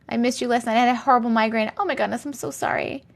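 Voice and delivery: ordinary talk, nothing marked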